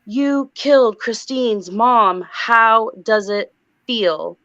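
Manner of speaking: slow, drawn-out mock demon scream; crazy demon voice. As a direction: stretch every word into a demonic accusation. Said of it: "in this crazy demon voice, she screams".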